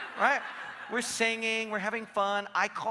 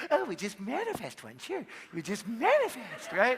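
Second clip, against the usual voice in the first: mocking wife; 3 semitones higher than usual. Wife mocks manifesting idea.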